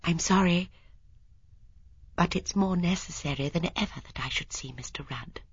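hushed, breath-light plea from a polite lady; determined Miss Marple. Acted. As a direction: apologize softly but hold your ground.

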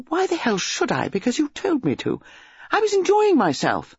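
put-on high-pitched, petulant young woman; indignant. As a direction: protest hotly in a lovers' quarrel. Acted lovers' quarrel.

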